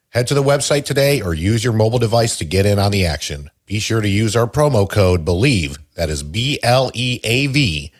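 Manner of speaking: punchy announcer-style promo read; measured, emphatic commercial delivery; sponsor ad read. Acted. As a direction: sell it like a sports radio spot, stretching key words. Reads a sponsor ad.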